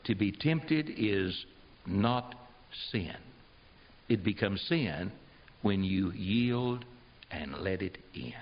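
slow and low, each phrase set apart with pauses; slow emphatic summary. Shown as a demonstration. Preacher driving home the lesson to the congregation, slow and deliberate. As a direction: lay it down word by word, slowly.

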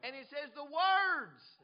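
"words" shouted and drawn out; stress on WORDS. Real, not acted. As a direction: hammer "words" high, slow and loud. Preacher hammers the plural point.

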